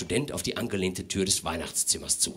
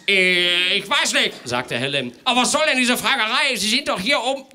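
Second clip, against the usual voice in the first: indignant host; 12 semitones higher, 6 dB louder than usual. Acted. Father gets flustered, indignant.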